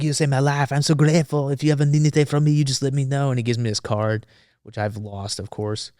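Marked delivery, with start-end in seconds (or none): 0.0-3.3 higher, gushing mock-French thanks; bad French accent. French reporter offering his rescuer any favor, bad French accent. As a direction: imitate a grateful Frenchman. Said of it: "if it's a bad French accent".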